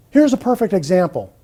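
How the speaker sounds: ordinary talk, nothing marked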